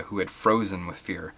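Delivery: usual speaking voice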